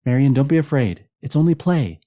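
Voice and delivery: high, loud woman's reassurance; Louise speaking up. A narrator's reading. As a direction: call reassurance across the dark room. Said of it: "Louise spoke up".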